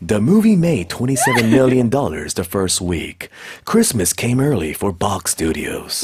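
slow movie trailer narrator. Shown as a demonstration. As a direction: deliver it like a trailer announcer. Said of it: "예고편 아저씨 목소리가 더 어울려".

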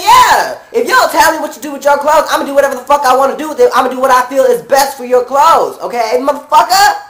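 acted-out indignant telling-off; loud angry comeback. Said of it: "get loud and motherfucking angry with you back".

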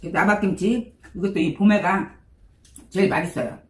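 speaking between spoonfuls; words after a bite; talking while eating. Real, not acted. Seen 0: Holds spoon, sips at 3.5s, keeps eating from bowl throughout.

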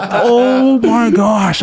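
very slow, drawn-out dismay; stunned realization. Retold or imitated. Caught insulting coworker on phone, slow stunned dismay. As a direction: let it sink in, stretched long.

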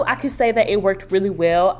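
ordinary talk

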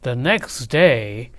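ordinary delivery, nothing marked

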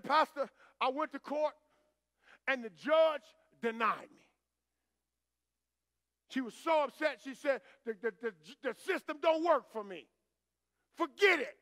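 upset caller. Retold or imitated. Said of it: "crying profusely on the phone".

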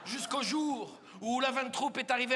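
ordinary talk, nothing marked

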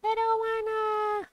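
high, slow whiny complaint. Real, not acted. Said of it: "I have to do Drake Rider".

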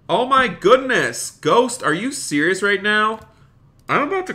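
high, loud, delighted disbelief; astonished outburst. Real, not acted. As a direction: exclaim at an unbelievable gift. Stunned by donation from viewer.

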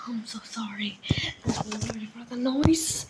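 hushed sorry whisper. Real, not acted. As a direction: whisper the apology so nobody wakes. Apologizing for noise, hushed.

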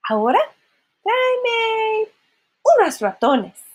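lightly voiced sing-song fairy order; fairy godmother. Fairy godmother asking for mice, lightly sung-out order. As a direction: hold the middle word long and high.

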